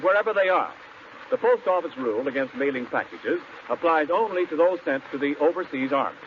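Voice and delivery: usual speaking voice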